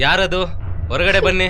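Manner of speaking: calling out. Acted. Challenging an intruder in house.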